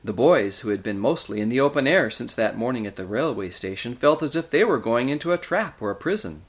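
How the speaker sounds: plain reading voice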